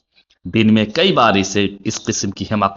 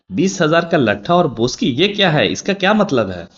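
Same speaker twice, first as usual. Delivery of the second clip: reading the note out much higher, astonished; amazed reading; 8 semitones higher, 4 dB louder than usual. A narrator's reading. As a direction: read it out high, baffled and amused. Girl reads the note, amused. (हैरानी से ऊँची आवाज़ में पढ़ना)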